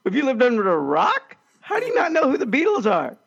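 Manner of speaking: high, incredulous outburst; retold astonishment, pitch leaping up; floored disbelief. Lecturer to student asking about the Beatles, floored. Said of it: "I was, like, floored".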